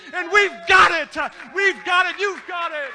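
roaring preacher climax; preaching at full force. Acted. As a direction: shout it out like a revival preacher.

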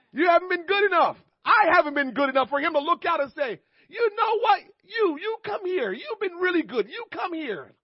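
very high, loud pulpit cry; high-pitched preacher's holler; impassioned preaching. Real, not acted. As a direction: cry it out to the congregation, acting the quote. Preaches at full force.

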